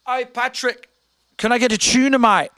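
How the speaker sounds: mock British customer calling out. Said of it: "he had a British accent".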